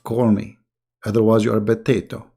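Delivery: normal talking voice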